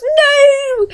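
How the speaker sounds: very high, drawn-out wail; acted squeal of denial; hammed-up high cry of dismay; Merry. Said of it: "and Merry goes".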